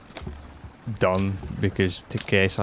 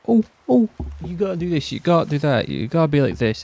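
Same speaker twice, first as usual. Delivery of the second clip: much higher, whiny mock voice of people nagging him; a sing-song mock lecture on how to behave; 7 semitones higher, faster than usual. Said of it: "everything's like".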